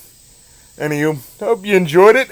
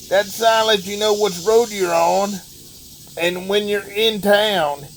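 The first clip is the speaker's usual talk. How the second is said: terrible Larry the Cable Guy. Acted. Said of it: "that was me doing a terrible Larry the Cable Guy impersonation".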